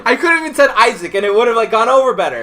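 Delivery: high, loud and half laughing; worked-up, amused regret; exasperated self-reproach. Real, not acted. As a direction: kick yourself while cracking up. Laughing self-reproach over giving real name.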